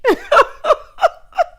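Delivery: high, loud burst of laughter; real high-pitched cackling laugh; loud cackle. Real, not acted. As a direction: crack up helplessly. Cracking up at her joke.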